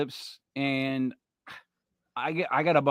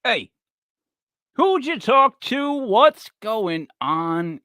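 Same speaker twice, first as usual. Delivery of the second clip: mock clip voice; 4 semitones higher, 4 dB louder, much slower than usual. Echoing the clip's voice.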